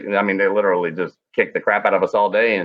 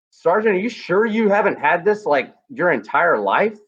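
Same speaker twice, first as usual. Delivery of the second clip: much higher, disbelieving question; can you believe it, pitched up; incredulous; 7 semitones higher than usual. Retold or imitated. Incredulous question late at night.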